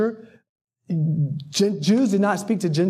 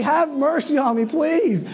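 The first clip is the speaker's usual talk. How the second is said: mock pleading; 7 semitones higher than usual. Acted plea to be spared noise.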